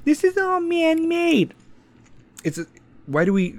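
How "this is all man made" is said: high, slow, drawn-out sarcasm; mocking the deniers in a sing-song voice; sarcastic mock voice. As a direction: say it with a mocking, exaggerated lilt. Sarcastic jab at climate deniers.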